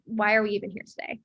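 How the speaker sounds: ordinary talk, nothing marked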